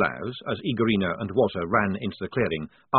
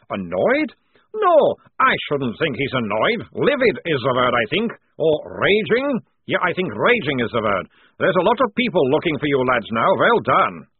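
louder, accented mock deliberation; sarcastic; 5 semitones higher, 7 dB louder than usual. A narrator's reading. Prisoner telling Polly how annoyed the prince is, sarcastically. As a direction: savour each stronger word with sarcasm. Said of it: "said Towering sarcastically".

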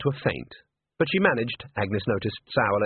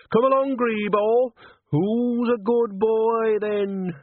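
coaxing a cat; 10 semitones higher, much slower than usual. A narrator's reading. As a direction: coo to the cat under the dresser.

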